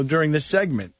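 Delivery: ordinary talk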